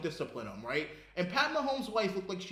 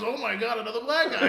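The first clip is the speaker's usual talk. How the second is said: mock excited greeting; 4 semitones higher, 6 dB louder than usual. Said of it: "And he goes".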